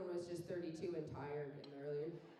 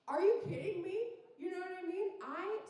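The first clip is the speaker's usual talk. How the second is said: exasperated; 10 semitones higher, 4 dB louder than usual. Acted. Exasperated at sister.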